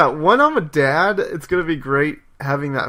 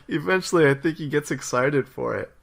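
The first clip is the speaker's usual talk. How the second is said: ordinary talk, nothing marked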